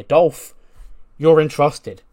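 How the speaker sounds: mock loud command. Group leader handing out orders, loud mock call-out. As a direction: announce the order like a ringside boss. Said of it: "And Cole goes".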